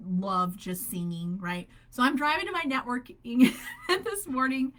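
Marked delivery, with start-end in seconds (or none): 1.9-4.7 higher, louder, laughing through words. Real, not acted. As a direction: laugh while you tell it. Laughing while telling the story.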